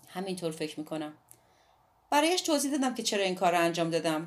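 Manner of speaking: usual speaking voice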